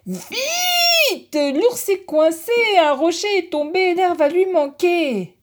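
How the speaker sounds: very high, loud screaming cry; ant screaming. Acted. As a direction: scream for help as loud as possible. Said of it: "hurle d'aussi fort que possible".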